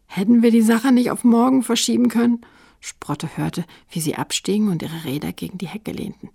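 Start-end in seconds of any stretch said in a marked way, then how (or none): 0.1-2.4 louder, higher whiny complaint; grumbling. A narrator's reading. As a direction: grumble loudly about being dragged along. (laut und hoch maulend)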